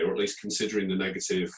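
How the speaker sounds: usual speaking voice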